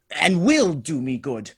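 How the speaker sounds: normal talking voice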